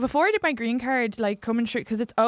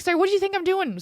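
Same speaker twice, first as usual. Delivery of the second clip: indignant; 8 semitones higher, faster than usual. Acted. Acted retort to immigration officer's questioning.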